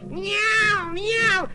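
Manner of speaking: squall like an angry cat. A narrator's reading. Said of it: "squalled the cat".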